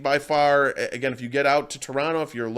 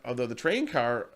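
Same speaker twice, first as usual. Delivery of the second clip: ordinary talk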